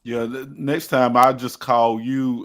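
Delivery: ordinary talk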